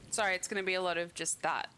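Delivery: usual speaking voice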